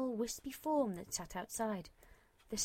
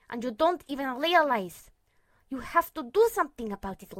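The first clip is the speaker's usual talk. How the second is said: loud, urgent pleading; forceful pleading; 4 semitones higher, 9 dB louder than usual. A narrator's reading. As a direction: press hard, raised voice. Lucid urges her forcefully.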